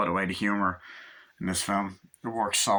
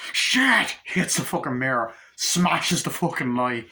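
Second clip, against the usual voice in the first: acted furious yell; 2 semitones higher, 3 dB louder, slower than usual. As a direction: blow up like an over-the-top movie hero. Acting out Cage smashing the mirror.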